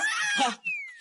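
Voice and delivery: a sudden high shouted laugh; delighted high laugh; high burst of laughter. Real, not acted. Amused laugh at doll noise.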